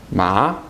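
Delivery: MA climbing at the end; rising tone. Shown as a demonstration. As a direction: say MA as if really surprised, pitch going up. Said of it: "Your voice goes up".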